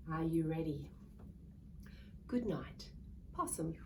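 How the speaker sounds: usual speaking voice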